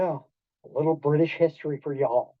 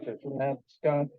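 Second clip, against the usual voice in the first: low and much softer, trailing off; fading nervous self-reproach; 5 semitones lower, 8 dB quieter than usual. Retold or imitated. Driver seeing police lights in the mirror, fading low worried repeat. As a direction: let the worry fade to almost nothing. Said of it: "You see that in the rearview mirror".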